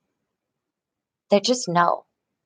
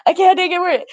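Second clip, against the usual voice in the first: upset protest; 9 semitones higher, 3 dB louder, faster than usual. Retold or imitated. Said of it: "it kept going on".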